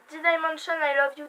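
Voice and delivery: title "D-Dimension I Love You" in an English accent. Shown as a demonstration. Booktuber showing off her English accent on a title. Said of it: "T'as vu mon accent".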